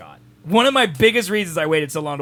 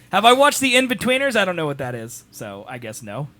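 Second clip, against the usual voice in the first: ordinary talk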